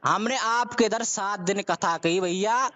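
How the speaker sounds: normal talking voice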